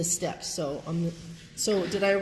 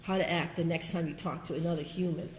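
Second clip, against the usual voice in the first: normal talking voice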